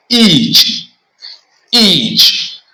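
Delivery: each spoken slowly with a long "ee"; pronunciation demo. Shown as a demonstration. Teacher modelling each, a long "ee". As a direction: repeat each clearly for the class. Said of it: "it's pronounced as".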